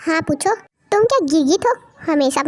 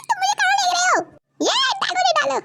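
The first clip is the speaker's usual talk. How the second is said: much higher panicked cries; frightened; 12 semitones higher than usual. Acted. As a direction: shriek while being dragged off. Tae being kidnapped. (घबराकर चिल्लाते हुए)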